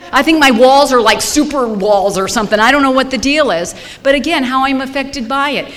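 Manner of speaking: loud, high and pressing, near a shout. Real, not acted. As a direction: push it out loud and high like you need to be heard. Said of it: "I don't think you hear me screaming".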